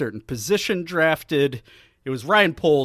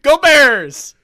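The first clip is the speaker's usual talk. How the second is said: rally the fans; loud cheer; 10 semitones higher, 10 dB louder than usual. Real, not acted. Bears fan cheering his team, loud shout. Cheer after mocking Packers.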